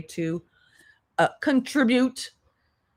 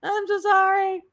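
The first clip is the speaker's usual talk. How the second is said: mock panicked apology; 12 semitones higher than usual. Mocking the streamer waking up after falling asleep, high frantic mock apology. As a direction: squeal a frantic sorry like you just woke up. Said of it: "She's like".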